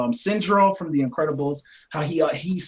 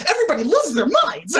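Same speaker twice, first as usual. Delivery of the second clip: high, raised-voice exclamation; Joker ranting; 10 semitones higher, 4 dB louder than usual. Retold or imitated. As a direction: burst out in mock outrage. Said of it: "in the hospital where he says".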